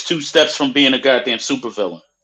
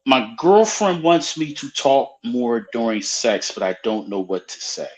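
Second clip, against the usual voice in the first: deliberate repeat; 3 semitones lower, 3 dB quieter than usual. Acted. Repeats question slowly after mock stammer.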